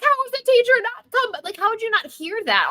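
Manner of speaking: high, incredulous outburst; can't believe nobody heard; exasperated disbelief. Retold or imitated. Host ranting about missing teachers, exasperated and high. Host's exasperated reaction retold.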